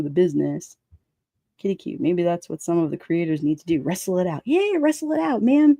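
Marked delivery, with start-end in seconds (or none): 4.4-5.8 high-pitched mock cheer; pitched-up, playful urging; playful egging-on. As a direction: egg them on like a wrestling fan. Eggs creators on, repeating it.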